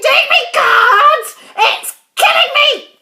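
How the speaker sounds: high, very loud, outraged shriek; screaming. A narrator's reading. Grandma protesting the medicine to George, outraged screaming. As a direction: scream it at the top of your voice. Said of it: "she screamed".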